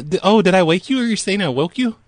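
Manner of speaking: normal talking voice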